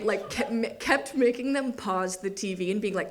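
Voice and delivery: ordinary talk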